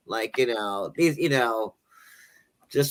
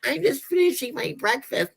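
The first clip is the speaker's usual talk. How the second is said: high, mock-innocent answer; wife's reply; 11 semitones higher than usual. Wife answering her husband, high mock-innocent reply. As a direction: answer him lightly, pitched up. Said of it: "It's like".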